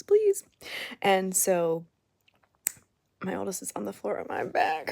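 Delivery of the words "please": much higher, louder please; pleading cry. Retold or imitated. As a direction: wail it out. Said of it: "And I'm like".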